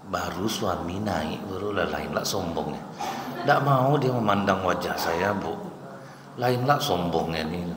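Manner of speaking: lightly voiced complaining neighbour; a hint of a catty whisperer; gossiping woman. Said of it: "Langsung ibu nih cerita".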